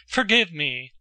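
ordinary delivery, nothing marked

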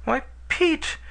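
put-on a bewildered girl protesting; astonished. A narrator's reading. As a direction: narrator voicing a surprised young girl. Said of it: "Astonishment swept over the girl's features".

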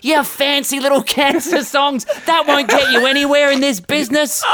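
3 preach showbiz wisdom like a pushy producer; 1 showbiz mentor voice. Retold or imitated. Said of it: "learned nothing from Daryl".

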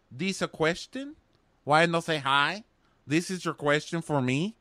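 put-on high, indignant Russian-accented questions; mock Russian woman, each question swinging up. [name] challenging Dean's question, mock Russian accent. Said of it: "Is that a Russian thing, just answering in questions?".

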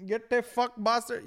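high, loud mock shout; imitated raging shop owner; angry shopkeeper refusing. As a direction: cut them off with a hammed-up yell. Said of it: "He's like".

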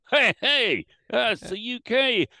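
high, loud mock Texan excitement; hammed-up Texan thrilled at a UK contact; excited Texan. Texan operator shouts with excitement at the UK contact. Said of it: "he goes".

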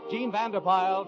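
ordinary delivery

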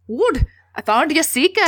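high mock Scottish accent; McGonagall. McGonagall presents Harry to Wood, mock Scottish accent. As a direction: call out proudly in put-on Scottish. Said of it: "that was better".

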